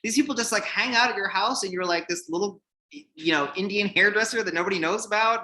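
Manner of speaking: high-pitched, incredulous retold disbelief; mock amazement, pitched well up; astonished editor. Stunned editor to unknown hairdresser after supermodel buzzes, astonished.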